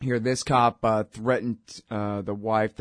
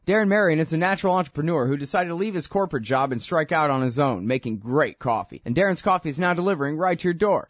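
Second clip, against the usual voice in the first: upbeat, higher sponsor pitch; ad read; 5 semitones higher than usual. Acted. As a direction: sell the coffee like a cheerful pitchman. Host reads coffee sponsor ad.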